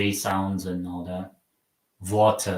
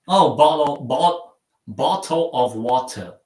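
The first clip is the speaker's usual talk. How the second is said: mock British accent; 4 semitones higher, 3 dB louder than usual. Shown as a demonstration. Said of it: "how would I say it naturally".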